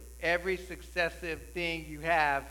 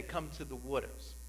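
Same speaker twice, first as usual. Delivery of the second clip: New Jersey accent; 4 semitones lower, 9 dB quieter than usual. Shown as a demonstration. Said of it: "in New Jersey, we say".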